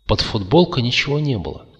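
plain reading voice

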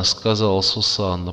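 plain reading voice

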